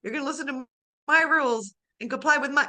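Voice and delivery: loud, high, scolding parent laying down rules; mock-scold, half laughing; mock strict parent. Coach voices parents scolding the child, loud mock-stern. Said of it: "And the parents like".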